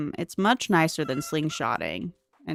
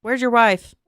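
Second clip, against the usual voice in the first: loud call; 4 semitones higher, 10 dB louder than usual. Real, not acted. Calling to game character.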